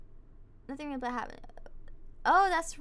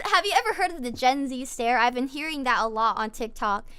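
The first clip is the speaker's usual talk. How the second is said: normal talking voice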